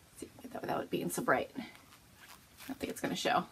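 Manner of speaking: fast whisper to herself; whispered aside. Real, not acted. Fussing with the camera light, quick whisper. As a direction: mutter it under your breath.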